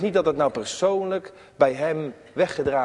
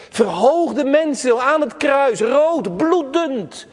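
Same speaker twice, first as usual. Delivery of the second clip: rising fervour; 9 semitones higher, 5 dB louder, slower than usual. Acted. Preaching climax on the cross.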